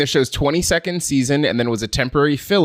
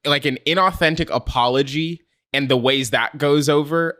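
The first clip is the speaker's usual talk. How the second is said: ordinary delivery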